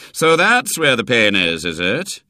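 put-on loud, sceptical old doctor; knowing, sardonic. A narrator's reading. Doctor catching out a malingering boy, sardonic. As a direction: pounce on it with heavy irony.

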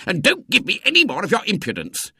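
high-pitched, outraged telling-off; irate professor losing his temper; angry scolding. A narrator's reading. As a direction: scold an impudent boy in fury. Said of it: "roared the professor angrily".

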